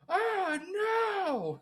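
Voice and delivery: high, drawn-out mock scream; retold scream. Retelling a stranger's scream in Costco, high wailing imitation. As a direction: imitate a stranger's anguished cry. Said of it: "I just heard someone fucking scream".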